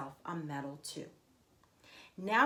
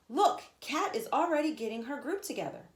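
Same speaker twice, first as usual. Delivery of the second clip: put-on excited kid voice; 6 semitones higher, 5 dB louder than usual. Kenny voiced in read-aloud story.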